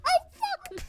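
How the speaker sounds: tiny high-pitched curse; very high rat squeak; alarmed rat. Acted. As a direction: swear as a startled little rat. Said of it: "he goes".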